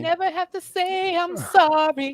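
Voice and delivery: put-on voice. Mock answer in put-on voice.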